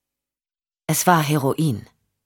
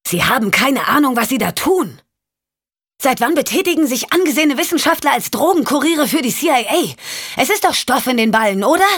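higher, pressing accusation; Michelle, accusing; 8 semitones higher than usual. Acted. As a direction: corner him with every question. Michelle confronts Champ heatedly.

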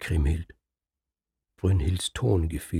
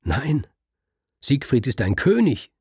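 higher and louder outburst; furious, rising protest; flaring up; 4 semitones higher, 5 dB louder than usual. Acted. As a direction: burst out in denial. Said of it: "Da braust Kriemhild auf".

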